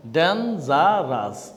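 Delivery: slow syllable demo. Shown as a demonstration. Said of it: "এটাও tree syllable".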